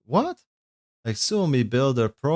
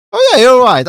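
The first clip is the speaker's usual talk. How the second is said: loud, high burst of realisation; real startled admission, pitched way up; sudden realisation; 14 semitones higher, 14 dB louder than usual. Real, not acted. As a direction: blurt it out as it dawns on you. Realises own mistake on stream.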